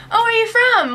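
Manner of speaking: a mild imitation of an eager local asking; mock curious stranger. Locals quizzing American students.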